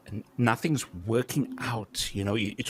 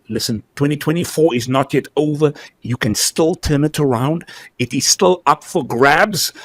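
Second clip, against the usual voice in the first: louder, rising rally call; rousing shout; 3 semitones higher, 9 dB louder than usual. Real, not acted. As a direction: pump up the audience, loud. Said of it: "I sound like an auctioneer right now".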